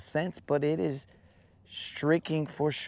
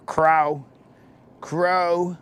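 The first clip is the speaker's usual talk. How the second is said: louder mock rooster call; rooster crow; 4 semitones higher, 5 dB louder than usual. Joking about roosters crowing outside, with a louder mock crow. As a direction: belt out a silly rooster crow. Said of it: "they're just out there".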